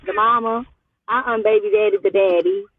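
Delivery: ordinary talk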